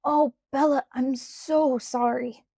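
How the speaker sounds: loud, high, stricken apology, then slow; rushed apology. A narrator's reading. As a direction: blurt out the sorry. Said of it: "He tripped over the words".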